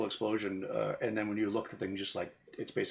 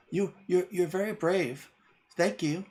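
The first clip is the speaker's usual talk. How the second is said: put-on fey girl voice; 8 semitones higher, slower than usual. Fey girl thanks Adrian.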